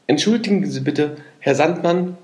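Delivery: high, polite and courteous; polite beetle voice. A narrator's reading. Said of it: "machte er eine sehr schöne Verbeugung".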